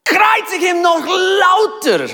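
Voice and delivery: high, loud, slow shout; crowd shout. Pretend. Preacher showing the crowd how to shout crucify him. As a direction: yell it as the angry crowd. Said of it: "Aber sie sagt".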